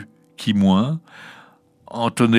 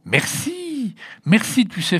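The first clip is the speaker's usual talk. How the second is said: put-on high, joyful child's thank-you; thrilled little girl, consoled; delighted thanks; 13 semitones higher, slower than usual. A narrator's reading. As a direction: gush with gratitude in a high voice. Consoled child thanks new friend.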